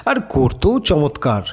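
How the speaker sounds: ordinary delivery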